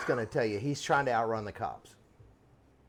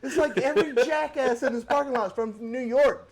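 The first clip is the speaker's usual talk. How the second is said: high, loud mock-incredulous voice; mocking parking attendant; 12 semitones higher, 7 dB louder than usual. Parking guy mocking New Yorkers, high and loud. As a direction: squeal it out in fake disbelief. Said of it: "And they're like".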